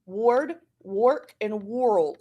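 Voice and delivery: "ward", "work", "world" as WORD rhyming with lord, WORK like pork, WORLD with "or"; by the "or" rule. Shown as a demonstration. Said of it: "one syllable rule, it'd be".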